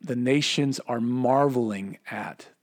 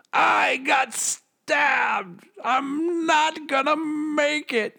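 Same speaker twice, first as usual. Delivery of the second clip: high, dragged-out panicked shout, hammed up; mock freaking out; 13 semitones higher, much slower than usual. Satan realising he's stabbed, hammed-up panicked shout. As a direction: wail it like a stabbed villain losing it. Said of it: "you could just hear him freaking out".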